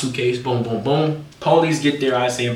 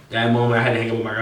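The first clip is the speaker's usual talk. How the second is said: ordinary delivery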